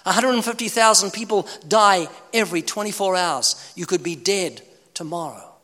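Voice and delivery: ordinary talk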